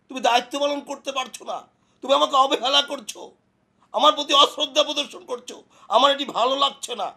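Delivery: high-pitched, aggrieved tirade; acted marital quarrel; indignant complaint. Acted husband's quarrel with wife.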